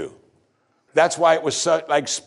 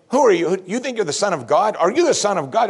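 higher, sneering questions; scornful high priest; 8 semitones higher, 3 dB louder than usual. Acted. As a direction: challenge him with contempt. High priest mocks Jesus at arrest.

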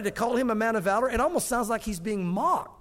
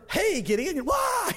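startled high squeak; 9 semitones higher, much slower than usual. Retold or imitated. Stranger suddenly surprising Gideon, squeaking high. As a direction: voice jumps way up on "why".

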